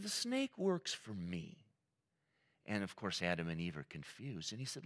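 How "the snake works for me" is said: slow, high-pitched repeat for effect; God's casual revelation. Retold or imitated. God telling confused Adam and Eve about the snake, slow reveal. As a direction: stretch it out high and let it land.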